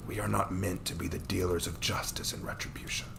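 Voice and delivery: soft whisper, grave; an old mentor whispering wisdom. Acted. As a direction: breathe it like a hard truth. Man's grave counsel.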